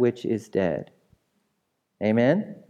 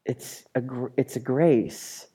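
ordinary talk

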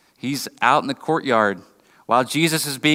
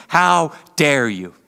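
slow, outraged accusation; through gritted teeth; much slower than usual. Acted. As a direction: spit out each word slowly through gritted teeth. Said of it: "probably gritting their teeth".

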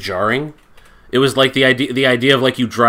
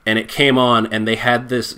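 ordinary delivery

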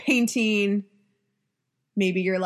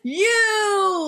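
excited shout; 12 semitones higher, 6 dB louder than usual. Real, not acted. Answering the greatest teacher.

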